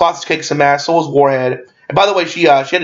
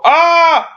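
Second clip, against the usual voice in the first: high drawn-out "ah!" yell; mock startled scream; retold yell; 4 dB louder than usual. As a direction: cry out from the trunk, pitched way up. Retelling the movie's trunk scream.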